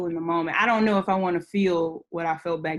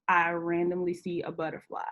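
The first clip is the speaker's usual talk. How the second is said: ordinary talk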